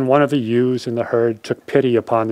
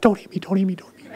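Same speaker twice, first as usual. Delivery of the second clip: frightened lamb; 8 semitones higher, 5 dB quieter, much faster than usual. A narrator's reading. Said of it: "And the lion said".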